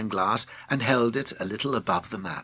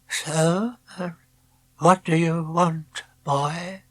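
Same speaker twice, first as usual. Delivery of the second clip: a lightly voiced old man, slow and gentle; a hint of an old painter speaking to a boy; soft kindly old man; 7 semitones higher, 4 dB louder, slower than usual. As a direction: ask kindly, like a grandfather. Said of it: "His voice was soft, but kindly".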